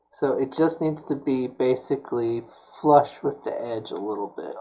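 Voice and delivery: ordinary delivery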